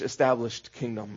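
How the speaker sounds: usual speaking voice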